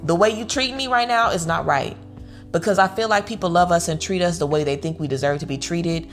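usual speaking voice

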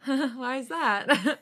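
slow, laughing through the words; nervous laugh. Acted. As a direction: giggle it out nervously. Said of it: "I laugh nervously".

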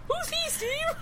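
high, laughing mock quote. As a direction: squeak it out through a laugh. Repeating running gag.

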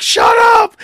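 high, loud snapping yell; angry shout. Retold or imitated. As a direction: brother yelling back at his mother. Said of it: "And he's like".

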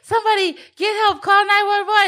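high-pitched, rushed cry for help; hammed-up emergency alarm; mock panic. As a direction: act out frantic pleading for rescue. Retold panic at fake choking.

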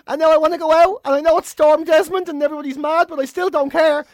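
high, loud, breathless gushing; overexcited dog. Acted. Dog greeting owner home from work, wildly excited. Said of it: "The dog looks at me and goes".

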